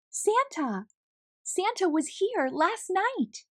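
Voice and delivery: put-on high, thrilled child calling out, slowing on the second line; child discovering Santa came, excited calling; excited shout. A narrator's reading. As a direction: burst out with joy. Tess wakes on Christmas morning.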